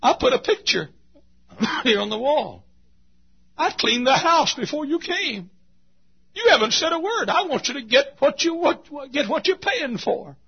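high, loud, pleading homeowner. Acted. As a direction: beg to be noticed for your effort. Sinner pleading with the buyer.

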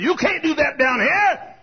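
high, loud scolding objection; the devil. Acted. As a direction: shout a protest in character. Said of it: "the devil walked up and said".

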